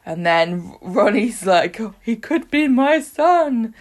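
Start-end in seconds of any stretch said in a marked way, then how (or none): 1.8-3.7 slow, high-pitched mock anguish; hammed-up paternity confession; dramatic reveal. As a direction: stretch it out like a soap cliffhanger. Repeats Ronnie's dramatic paternity reveal.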